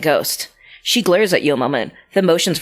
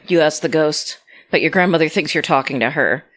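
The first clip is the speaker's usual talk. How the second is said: ordinary talk, nothing marked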